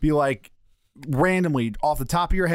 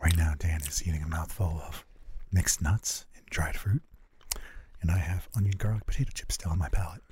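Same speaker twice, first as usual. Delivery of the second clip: breathy ASMR hush; ASMR whisper; 10 semitones lower, 7 dB quieter than usual. Pretend. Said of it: "Talk Me Into A S M R".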